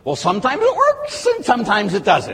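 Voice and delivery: hammed-up lukewarm doubt; mock shrugging doubter. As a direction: shrug it off like a half-believer. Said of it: "what do you believe?".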